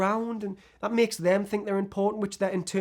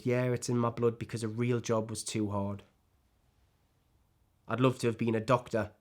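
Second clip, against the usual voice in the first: low, soft, deflated confession; bitter self-blame; 7 semitones lower, 6 dB quieter than usual. Acted. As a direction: turn the blame quietly on yourself. Subdued self-blame after the rant.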